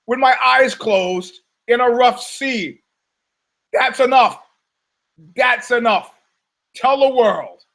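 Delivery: high, sustained theatrical proclamation; mock-heroic shout; hammed-up boasting. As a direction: proclaim your feat to the whole world. Hammed-up boast about his own feat.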